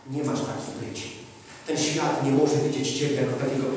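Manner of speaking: ordinary talk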